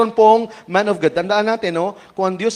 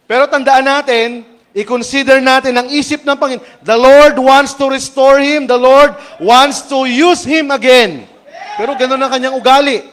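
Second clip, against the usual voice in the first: preaching at full force; 7 semitones higher, 8 dB louder than usual. Acted. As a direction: drive the point home to the congregation. Preacher proclaims God's intent.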